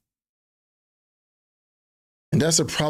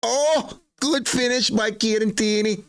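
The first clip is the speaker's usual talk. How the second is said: Scottish commentator; 10 semitones higher than usual. Pretend. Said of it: "What kind of Scottish accent is that?".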